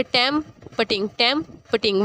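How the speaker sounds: "टैम putting" in two beats, break after टैम; split into syllables. Shown as a demonstration. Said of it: "word के दो हिस्से हो गए". ("टैम putting" दो हिस्सों में अलग-अलग)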